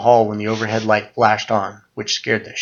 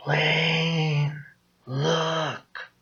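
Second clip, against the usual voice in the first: high, soft, eerie drawn-out call; sinister ghoul voice; 6 semitones higher, 6 dB quieter than usual. A narrator's reading. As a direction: coax him to look like a demon. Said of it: "a low, sinister, subhuman growl".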